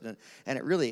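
ordinary delivery

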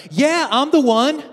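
high, loud, cocky show-off voice; boastful Jesus. Acted. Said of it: "Jesus doesn't do this".

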